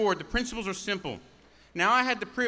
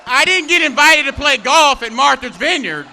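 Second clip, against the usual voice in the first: roaring rallying speech; 8 semitones higher, 12 dB louder than usual. Acted. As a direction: bellow it at the crowd. Rally speaker shouting at crowd.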